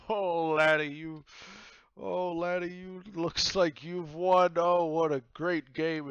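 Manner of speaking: loud, high, drawn-out voice; slow, booming hearty praise; jolly drunk captain. Acted. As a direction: congratulate with boozy gusto. Drunk captain congratulates the winner.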